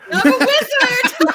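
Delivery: very high, loud laughing exclamation; gleeful mock amazement. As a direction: squeal it slowly through laughter. Delighted answer to the film quote.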